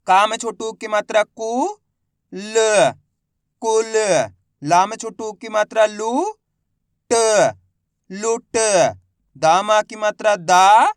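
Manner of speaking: slow spelling-out with pauses between syllables; letter-by-letter reading. Shown as a demonstration. Teacher leading pupils to repeat, letter by letter. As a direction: sound out each letter, then blend the word. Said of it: "मेरे साथ साथ इनको repeat करना है".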